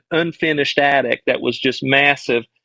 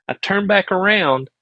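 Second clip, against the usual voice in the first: ordinary delivery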